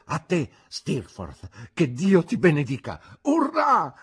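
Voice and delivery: loud toast building to a high cheer; shouted toast. A narrator's reading. Tipsy host toasting his friend's health, shouting. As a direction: raise the glass and cry it out, peaking high.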